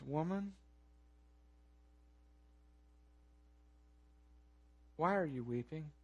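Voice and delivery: very soft, low, with a long pause; gentle question. Retold or imitated. Jesus to weeping Mary at the tomb, gentle hushed question.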